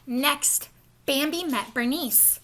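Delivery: usual speaking voice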